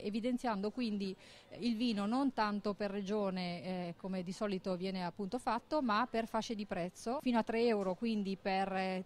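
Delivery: ordinary talk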